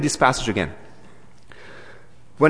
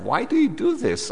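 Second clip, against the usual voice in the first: mock complaint; 12 semitones higher than usual. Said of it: "we kinda cry out and say".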